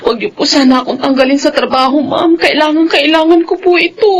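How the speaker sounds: crying, begging the employer; nanny begging her employer, crying, not to fire her; tearful pleading. Acted. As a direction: plead through tears.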